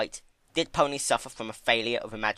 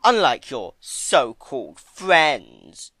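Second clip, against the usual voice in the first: slow, much louder scornful villain; louder, drawn-out menacing voice; Discord sneering; 3 semitones higher, 10 dB louder, much slower than usual. A narrator's reading. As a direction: sneer the words out.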